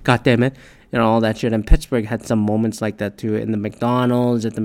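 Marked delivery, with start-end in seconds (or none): 0.0-0.6 loud, higher chanting demand; mock protester yell. Protesters shouting at diners, loud mock yell. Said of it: "it's like".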